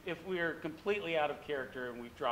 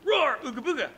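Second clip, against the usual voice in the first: higher, louder ooga booga call; gorilla roar; 8 semitones higher, 6 dB louder than usual. Shown as a demonstration. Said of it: "So he may go".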